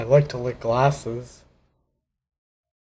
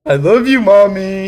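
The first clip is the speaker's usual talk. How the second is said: excited; 8 semitones higher, 10 dB louder than usual. Acted. Nick pleading with mom toy.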